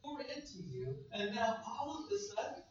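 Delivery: Paul's heartbroken astonishment, pitched high; astonished. Acted. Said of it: "this is why Paul is angry".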